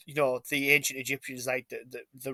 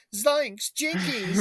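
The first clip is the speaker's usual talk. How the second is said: a touch higher, mock cartoon exclamation; Scooby-Doo shout; 7 semitones higher, much slower than usual. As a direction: toss it off like a cartoon sidekick. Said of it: "ran around going".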